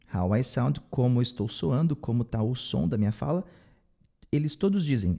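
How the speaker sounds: usual speaking voice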